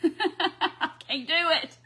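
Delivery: slow words through real laughter; laughing. Real, not acted. Crafter failing her own moment of silence, laughing. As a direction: giggle it out, unable to stay silent.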